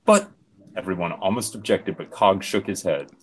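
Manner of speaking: ordinary talk, nothing marked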